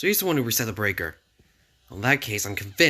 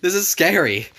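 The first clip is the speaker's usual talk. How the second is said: scared outburst; 8 semitones higher, 7 dB louder, slower than usual. Acted. Character frightened by speech.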